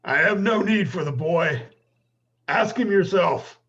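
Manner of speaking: loud, high in-character retort; defiant calling out. Acted. Wounded orc to villagers about the boy, calling out defiantly. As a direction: call it out to the attackers, slowing at the end. Orc answers attackers across clearing.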